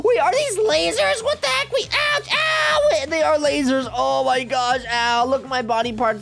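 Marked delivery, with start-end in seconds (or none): 0.0-2.9 high, alarmed yelping; startled mock pain. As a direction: climb into squealed ouches as if zapped. Hit by lasers in game.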